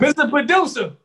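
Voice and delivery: laughing while calling out. Real, not acted. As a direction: call him out through a laugh.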